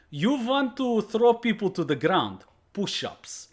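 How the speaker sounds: imitating the Russian sensei punishing his student; sensei's stern accent. Sensei scolding student at dojo.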